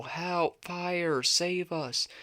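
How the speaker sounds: storybook cries of help, high-pitched; calling for help. A narrator's reading. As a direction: pretend cubs calling out from afar. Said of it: "he heard them call".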